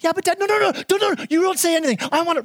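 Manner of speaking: put-on high whiny kid voice; sibling jumping in. Younger sibling interrupting dad, high whiny protest. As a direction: rush to get your side in first.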